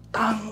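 a soft high ping like a flicked mug; small high metallic tang, flicked glass; imitated glass ping. Menirukan bunyi gelas disentil. (tiruan bunyi gelas disentil pelan)

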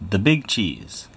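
plain reading voice

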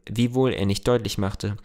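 ordinary delivery

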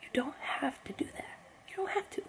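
soft unvoiced whisper. Real, not acted. Vlogger whispers a plea to the rapper, hushed. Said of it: "I had to whisper".

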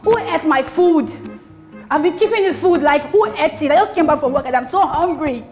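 high, loud, drawn-out yell; yelling across the room. Acted. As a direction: call out for the culprit, hungry and loud. Hungry woman yelling at household.

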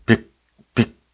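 빅 stopped short on the final consonant; two separate, quick clipped takes of 빅; deep, abrupt sound. Shown as a demonstration. Teacher demonstrating the abrupt pronunciation, 빅 clipped short. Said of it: "빅의 깊고 급한 발음".

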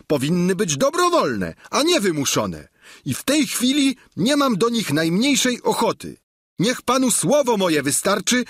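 a stubborn peasant standing up to his master; Sancho, the stubborn squire. A narrator's reading. Sancho voiced throughout. (wysoki, głośniejszy, uparty głos giermka)

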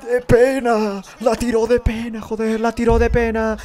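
high, loud outburst at a miss; full-voiced fan shouting; excited yelling. Real, not acted. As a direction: yell it out in disbelief. Shouting at a bad penalty.

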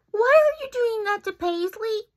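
high, loud brave little voice; small bunny challenging the bully; brave challenge. Acted. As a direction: stand up to him. Pika confronts bully.

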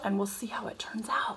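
softly whispered aside. Real, not acted. Mom whispering an aside while cooking, whisper. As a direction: drop to a whisper.

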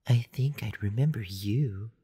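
slightly altered, entranced female voice; voice not quite her own. A narrator's reading. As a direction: speak as if lightly under a spell. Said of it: "a voice that wasn't quite her own".

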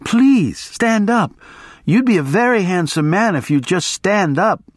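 high, wheedling woman's plea; imploring, starting very high; pleading. A narrator's reading. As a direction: coax and beg in a lifted voice. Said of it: "she pleaded".

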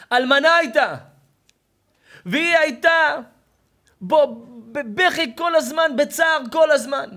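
high, full-force preacher's cry; slow, anguished proclamation at top volume; impassioned shouting. Real, not acted. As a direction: belt out the sorrow to the crowd. Preacher cries out the mother's suffering.

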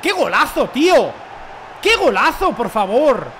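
high, loud, thrilled shouting; celebración de golazo. Real, not acted. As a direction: marvel at the goal out loud. (gritos de asombro eufórico)

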